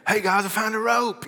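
high, loud Southern holler; Southern accent. Acted. Friend on the roof calling to his buddies, Southern accent. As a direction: call out excitedly with a Southern accent. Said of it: "he's got a Southern accent".

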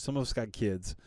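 usual speaking voice

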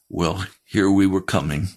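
usual speaking voice

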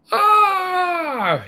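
high, loud scared scream; frightened yell. Acted. Serotonin yells at the loud bang, frightened scream. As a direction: shriek like the sky just fell. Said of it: "yelled serotonin".

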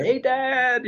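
very high imitated child's call; kids calling out. Imitating kids in the back calling to their dad, high call.

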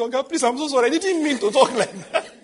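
high, loud apology breaking into laughter; flustered laughing apology. Retold or imitated. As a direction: apologize in a rush, laughing at himself.

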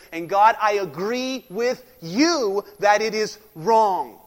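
loud, emphatic sermon delivery; forceful preaching. Acted. Preacher driving the point home to the congregation, forceful. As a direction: preach it hard, punching each line.

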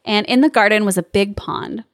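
usual speaking voice

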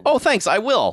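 bright, chirpy thank-you; high naive voice. Retold or imitated. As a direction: play the clueless victim. Naive victim voiced in recap.

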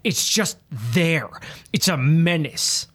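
ordinary delivery

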